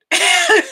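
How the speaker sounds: high loud laugh; real high laughing burst; burst of laughter. Real, not acted. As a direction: crack up with a sudden high laugh.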